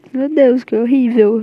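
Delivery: loud, appalled cry; loud, half-joking disgust; horrified exclamation. Real, not acted. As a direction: recoil in mock horror at the thought. Horrified at smelling bad.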